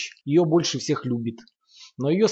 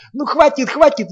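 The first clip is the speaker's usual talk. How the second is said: much higher, louder squealing; shrill squeal; 12 semitones higher, 10 dB louder than usual. A narrator's reading. Sasha squealing for Lomov to stop, shrill squeal. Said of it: "визжит Саша".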